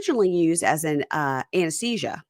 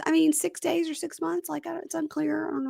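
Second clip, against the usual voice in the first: wife; 10 semitones higher, 5 dB quieter than usual. Retold or imitated. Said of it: "She goes".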